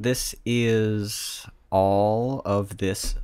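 very slow, dragged-out intro; slow stumble. Real, not acted. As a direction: drag out the words while searching. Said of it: "Th-, wrong".

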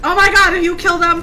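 high, very loud outcry; horrified yell. Real, not acted. Player seeing gnomes killed, horrified yell. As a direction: cry out in real shock.

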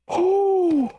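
sharp high gasp; quick frightened intake of breath; startled gasp. Real, not acted. Startled during game.

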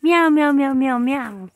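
light mock meowing; calling a kitten. Niece calling the kitten with playful light meows.

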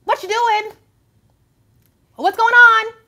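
high, rising to loud mock outrage; imitating an incredulous onlooker; mock disbelief. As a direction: throw up your hands in disbelief. That's when we can say.

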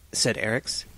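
ordinary delivery

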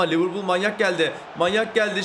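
usual speaking voice

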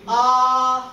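Ah held loud, high and level; loud first tone. Shown as a demonstration. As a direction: sing out a long level Ah. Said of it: "Yes, okay, loud".

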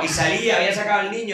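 ordinary delivery

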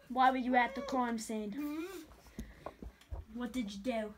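ordinary talk, nothing marked

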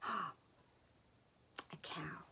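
whisper. Acted. Secret reveal whisper.

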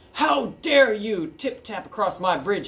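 mildly hollered troll threat; hollering troll. Acted. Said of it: "he hollered".

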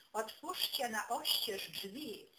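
ordinary delivery, nothing marked

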